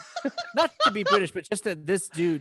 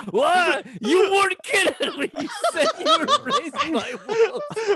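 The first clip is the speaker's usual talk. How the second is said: shouted through laughter, much higher and louder; laughing shout; 4 dB louder than usual. Retold or imitated. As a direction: yell the line out while howling with laughter. Replaying the line, cracking up.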